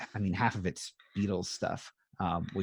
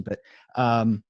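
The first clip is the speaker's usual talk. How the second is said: put-on lightly done British accent, higher and x1.5 fast; 7 semitones higher, 4 dB louder than usual. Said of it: "he said it with a very nice accent".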